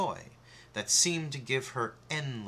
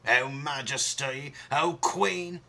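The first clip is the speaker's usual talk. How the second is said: louder eager huntsman call; 4 semitones higher, 4 dB louder than usual. Acted. Huntsman reporting to the queen, calling out. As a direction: hail the queen proudly. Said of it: "he cried".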